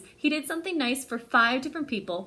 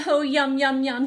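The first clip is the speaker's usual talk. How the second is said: louder, relishing yum yum; delighted yum; 3 semitones higher, 8 dB louder than usual. Pretend. Paperboy Billy gobbling muffins, relishing. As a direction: savor it like a tasty muffin.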